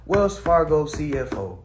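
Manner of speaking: ordinary delivery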